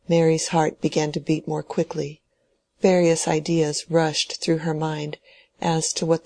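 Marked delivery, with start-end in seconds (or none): none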